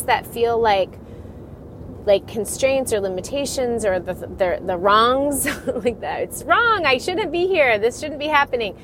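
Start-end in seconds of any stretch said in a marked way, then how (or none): none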